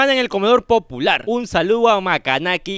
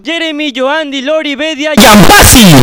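raised-pitch roll call exploding into a bellow; hyped name announcement; 4 semitones higher, 9 dB louder than usual. Acted. Streamer announcing a donor, building to a huge shout. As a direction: build through the names and roar the last.

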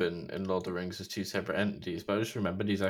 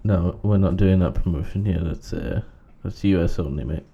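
cashier voice; 2 semitones lower, 7 dB louder than usual. Acted. Cashier refusing in skit.

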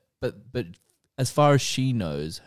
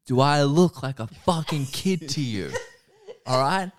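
mock furious telling-off, pitched up toward the end; hammed-up outrage at the waiter over kids' nuggets; retold angry rant; 5 semitones higher than usual.

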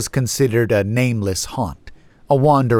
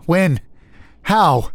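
panicked; 8 semitones higher, 3 dB louder than usual. A narrator's reading. Narrator panics, running out.

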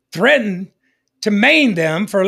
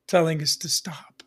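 whispered aside; 4 semitones lower, 7 dB quieter than usual. Acted. Sermon aside.

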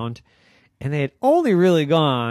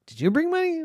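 much higher squeaky question; high-pitched repeat; 3 dB louder, faster than usual. Acted. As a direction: ask again in a high squeak.

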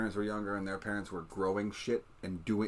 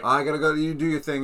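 busy parent; 6 semitones higher, 6 dB louder than usual. Retold or imitated. Parent voiced in a retold scene.

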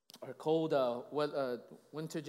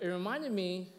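ordinary talk, nothing marked

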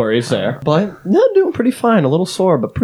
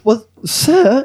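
high, put-on British lady; British female accent; 8 semitones higher than usual. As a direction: answer back as a high-voiced British woman. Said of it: "I just wanted a British female accent".

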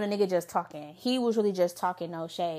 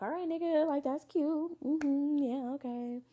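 high sarcastic brush-off; 5 semitones higher, 6 dB quieter, slower than usual. Retold or imitated. Dismissive reply to date.

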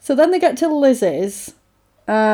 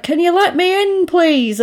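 high-pitched call to be let in; high, imploring request; pleading call; 8 semitones higher than usual. Retold or imitated. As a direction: call up to a window, voice raised high. Acted calling in at window.

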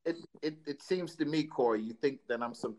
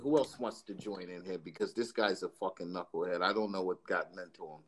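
muttered aside; 7 semitones lower, 5 dB quieter than usual. Real, not acted. Host mutters aside about the caller.

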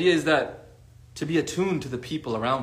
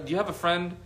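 usual speaking voice

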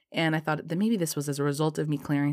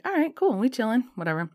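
mock chill; 7 semitones higher, 3 dB louder than usual. She mimics her relaxed inner reaction.

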